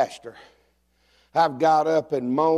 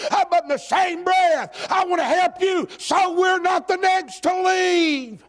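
rising sermon; 10 semitones higher than usual. Acted. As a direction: drive it home to the pews. Preaching climbs again.

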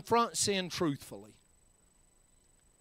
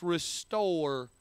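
ordinary delivery

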